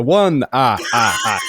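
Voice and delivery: put-on loud Transylvanian accent with a counting laugh; Count von Count voice. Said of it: "the count from, uh, Sesame Street".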